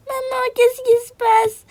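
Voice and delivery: voix d'enfant aiguë et lente; petite fille apeurée. Acted. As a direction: jouer une fillette inquiète. (fillette demandant à son père ce qu'il fait)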